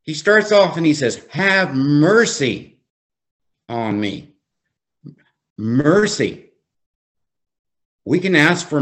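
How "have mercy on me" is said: slow scripture reading, dropping away at the end; slow, emphatic reading. Real, not acted. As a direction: read it slowly, weighting MERCY. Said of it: "I'm gonna read it slowly".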